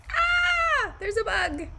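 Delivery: high mock shriek of alarm at a bug; playful fake fright at spotting a bug; startled cry. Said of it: "you immediately were like".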